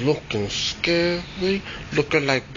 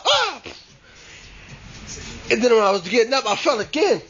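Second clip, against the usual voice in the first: plain reading voice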